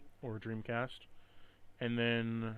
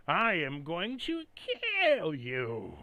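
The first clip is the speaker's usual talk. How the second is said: slow, hammy B-movie threat; bad voice acting; 5 semitones higher, 5 dB louder, much slower than usual. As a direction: overact a cheesy villain. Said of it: "really bad voice acting".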